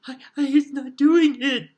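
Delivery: very high, loud squeaky elf squeal; a terrified elf squealing her denial. Acted. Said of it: "she squealed".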